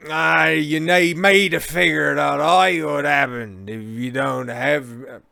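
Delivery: put-on slurred, slow drunk English accent; a drunk Englishman boasting. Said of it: "grab bag of English accents".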